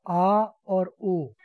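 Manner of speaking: आ और ओ stretched very slowly; sounds drawn out. Shown as a demonstration. Teacher to class, slowly modelling vowel sounds. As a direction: hold each vowel long. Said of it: "तीन secondary आवाज़ें".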